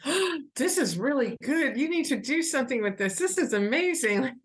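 high-pitched, gasping delight; imitated enthusiastic praise; excited. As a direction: gush as an impressed fan. Retells excited reaction of readers.

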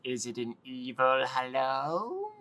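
slow, sinister evil hello; Doctor Evil voice. Pretend. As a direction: play it like a hammy supervillain. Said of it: "I say hello like Doctor Evil".

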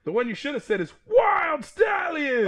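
shouted high and loud, bursting with excitement; excited shout. Real, not acted. Cohost shouting about a movie he wants, excited. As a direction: yell it out like a fan who just can't wait.

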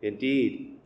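normal talking voice

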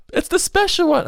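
very high wailing cry; imitated child scream. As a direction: shriek like a toddler. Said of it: "screaming his head off".

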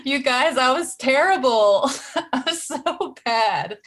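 high, loud, laughing self-mockery; laughing confession. Real, not acted. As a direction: confess it to friends through laughter. Laughing confession of her own failure.

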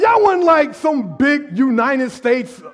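high, loud and fast teasing rant; God mocking tiny Israel, shouting. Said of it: "He's like".

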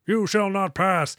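high, slow mock wizard proclamation; Gandalf style. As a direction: boom it out like Gandalf at the bridge. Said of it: "Gandalf style".

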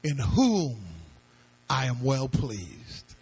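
low, slow, solemn divine pronouncement; God speaking with weighty pauses; voice from heaven. Acted. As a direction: drop deep and measure out each phrase. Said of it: "a voice came from heaven saying".

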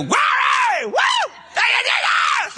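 high, ecstatic church shout; wild, piercing hallelujah holler; shouted praise. Acted. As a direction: whoop out praise at full voice. Acting out his loud praise outburst.

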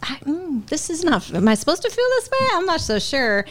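high, hesitant wondering; mock-confused, half to herself; puzzled inner voice. New mom in labor wondering what she feels, high and puzzled. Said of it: "I'm like".

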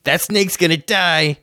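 threatening, rising to a high shout; gruff Jim Bob koala. Acted. GM voicing Jim Bob threatening the party, shrill threat. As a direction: snarl it like a cornered bandit. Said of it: "and Jim Bob says".

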